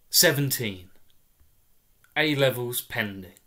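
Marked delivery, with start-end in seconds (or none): none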